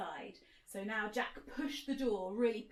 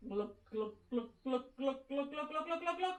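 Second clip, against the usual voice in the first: rising glug glug, climbing higher each time; glugging sounds; 5 semitones higher, faster than usual. Shown as a demonstration. Teacher acting out drinking from a giant cup, glugging higher and higher. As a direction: gulp it down, getting higher. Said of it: "so pick it up".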